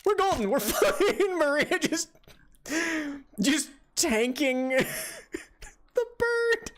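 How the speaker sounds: high-pitched giggling words; can barely talk for laughing; laughing hysterically. Real, not acted. Laughing hard at teammate dying.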